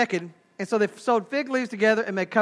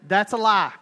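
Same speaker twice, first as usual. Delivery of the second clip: normal talking voice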